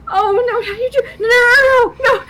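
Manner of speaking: high, mock-crying string of no; dismayed wailing. A narrator's reading. Althera wailing as the captain forgets her. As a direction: wail it like a heartbroken fan.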